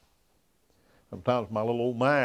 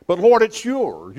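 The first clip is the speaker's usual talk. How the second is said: higher, louder and slow, pleading; earnest prayer cry; 10 semitones higher, 10 dB louder, slower than usual. Acted. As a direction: lift it to the Lord like a full surrender. Preacher voicing surrender prayer.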